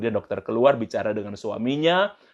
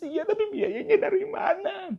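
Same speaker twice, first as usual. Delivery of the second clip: high, slow tearful whine; mock crying; 4 dB quieter than usual. Wife to husband about the hospital bill, mock crying. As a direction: imitate a wife wailing her worry. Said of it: "Istrinya katanya nangis".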